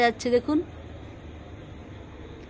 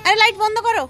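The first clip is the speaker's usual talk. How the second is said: pitched-up, carrying questions; calling out; 9 semitones higher, 3 dB louder than usual. Real, not acted. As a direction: call eagerly across the rooftop. Calls to child on dark rooftop.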